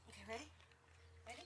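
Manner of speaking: ordinary delivery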